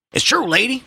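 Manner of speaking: put-on high, loud old man's protest; crying out. A narrator's reading. Old man to the mother, crying out. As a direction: call out to her insistently. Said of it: "the old man cried".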